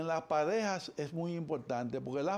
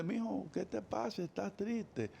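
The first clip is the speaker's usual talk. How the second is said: soft, tender, slow; calm the child down gently; gentle mother; 5 semitones higher, 4 dB quieter than usual. Shown as a demonstration. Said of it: "En vez de decirle". (madre cariñosa, voz suave)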